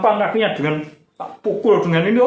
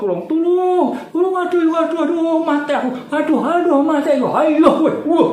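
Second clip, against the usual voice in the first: mock shouting for help; 12 semitones higher than usual. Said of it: "Bengok-bengok".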